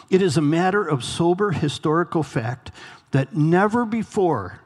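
ordinary delivery

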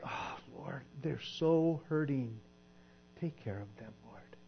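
hammed-up churchy whisper; mock pious prayer.

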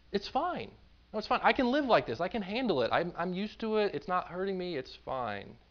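high-pitched, brushing-off insistence; dismissive denial. Retold or imitated. Hoarders answering the people trying to help, dismissive and defensive. Voicing hoarders who deny the problem.

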